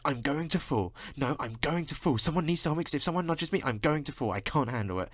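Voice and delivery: lightly mocked complaining woman on the train; mock whiny woman. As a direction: whine it like a fussy passenger. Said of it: "this woman was saying to this guy".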